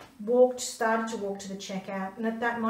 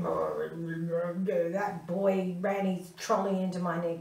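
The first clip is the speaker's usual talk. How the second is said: slow, lower, gruff man's complaint; a hint of an irritated old man grumbling; grumpy stranger; 3 semitones lower, slower than usual. Mother voices the man scolding about his knee, slow and grumbling.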